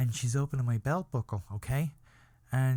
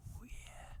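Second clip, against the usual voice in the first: very soft, breathy whisper; hushed aside of pretend enjoyment; whispered pleasure; 20 dB quieter than usual. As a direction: slip in a mock-lustful whisper. Acted pleasure between fake sobs.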